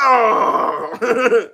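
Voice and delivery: long, loud groan of disgust. Real, not acted. Streamer reacting to a band he hates, disgusted groan. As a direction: let out a drawn-out "ugh". Disgust at Motley Crue early days.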